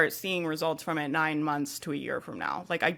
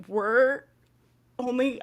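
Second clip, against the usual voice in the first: higher, tearful voice; crying; 5 semitones higher, slower than usual. Real, not acted. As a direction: choke up mid-sentence for real. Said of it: "I don't know why I'm crying about this".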